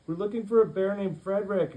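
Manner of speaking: high, loud explanation; earnest shout. Acted. Jonathan shouting an explanation across to the pirates. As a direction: call over to the pirates.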